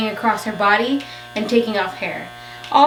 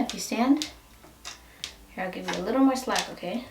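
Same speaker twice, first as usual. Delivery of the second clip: soft coaxing, quieter; 8 dB quieter than usual. Real, not acted. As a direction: gently coax her to stand. Coaxing puppy to stand.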